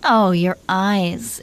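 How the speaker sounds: normal talking voice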